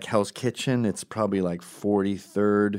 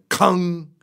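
loud, drawn-out metallic clang noise; imitated cell-door clang; 9 semitones higher, 6 dB louder than usual. Ex-con recalls the cell door closing, imitated clang. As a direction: mimic a jail door slamming shut. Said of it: "the actual closing of the, the jail".

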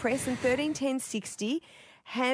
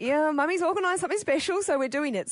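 insistent mum; 6 semitones higher than usual. Retold or imitated. Mum overruling child, retold.

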